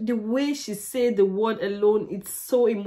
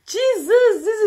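awed exclamation; 11 semitones higher, 3 dB louder than usual. Real, not acted. Reacting to a powerful singer.

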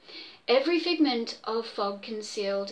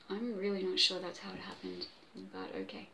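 muttered aside, much softer; 5 semitones lower, 11 dB quieter than usual. Real, not acted. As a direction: drop out of the story for a dry aside.

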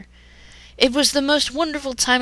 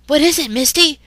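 confused alarm; 6 semitones higher, 7 dB louder than usual. Acted. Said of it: "Ash asked, confused".

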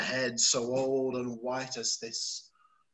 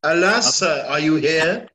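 louder, calling out through the storm; alarmed call; 7 dB louder than usual. Acted. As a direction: call out anxiously over the wind. Kent's lines read loud.

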